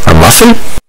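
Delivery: put-on loud, sour teenage scoff; a grumpy son unimpressed by the offering; sour question. Teen son to mother over breakfast muffin, sour and loud. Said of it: "he questioned sourly".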